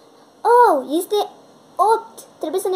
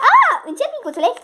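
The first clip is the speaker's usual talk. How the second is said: excited squeal; 9 semitones higher, 6 dB louder, faster than usual. Acted. Girl calms new puppy.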